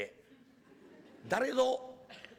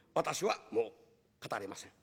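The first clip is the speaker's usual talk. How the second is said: sulky refusal; 10 semitones lower, 3 dB quieter than usual. Acted. Master sulks, refuses.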